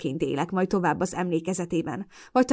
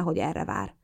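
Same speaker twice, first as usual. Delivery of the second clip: ordinary talk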